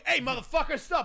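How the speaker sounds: high, loud retold police challenge; super aggressive. Retelling a police stop, aggressively shouted. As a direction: imitate a cop yelling a command. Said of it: "getting super aggressive with me".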